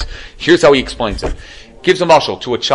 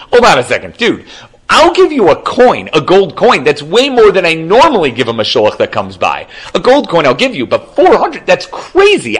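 high, loud, exasperated haggling; acted disbelief, pitch jumping up; incredulous protest; 6 semitones higher, 5 dB louder than usual. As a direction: protest as if the demand is absurd. Rich man protests the huge demand.

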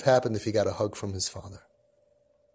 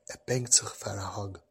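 hushed urgent tip; 5 semitones lower, 10 dB quieter than usual. Retold or imitated. Telling grandfather secretly, kid nearby.

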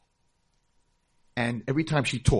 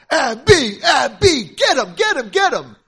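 high, loud shouted cheering; egging on; 14 semitones higher, 15 dB louder than usual. Retold or imitated. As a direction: yell like a kid cheering on a fight. Kids egging her on.

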